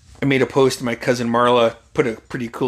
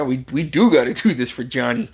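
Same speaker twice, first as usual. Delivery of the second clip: usual speaking voice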